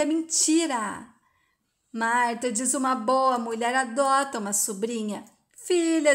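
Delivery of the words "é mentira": understated cry of protest; mild shouted denial. A narrator's reading. Joyce rejects Marta's account of her birth, a mild shout. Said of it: "Joyce grita".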